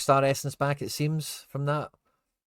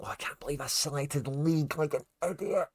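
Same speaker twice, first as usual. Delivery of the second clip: normal talking voice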